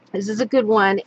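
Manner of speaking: ordinary delivery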